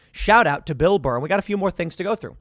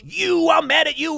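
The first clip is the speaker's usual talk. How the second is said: much higher, hammed-up angry guy; mock angry man; 11 semitones higher, 3 dB louder than usual. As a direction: whine it like a cartoon grump. Angry man accosts lady.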